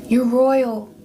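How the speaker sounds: ordinary talk, nothing marked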